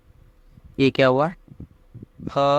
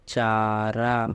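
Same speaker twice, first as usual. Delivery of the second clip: slowly spelled out; slow reading demo; 2 semitones lower, much slower than usual. Shown as a demonstration. Teacher showing students how to read, slow. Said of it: "और जैसे".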